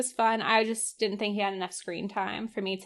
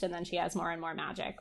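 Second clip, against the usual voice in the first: usual speaking voice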